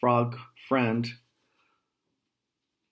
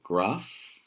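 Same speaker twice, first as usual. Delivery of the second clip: ordinary delivery, nothing marked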